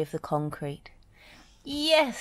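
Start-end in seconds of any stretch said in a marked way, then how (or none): 1.6-2.2 high joyful squeal; delighted yes. A narrator's reading. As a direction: squeal with relief. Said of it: "squealed Dizzy".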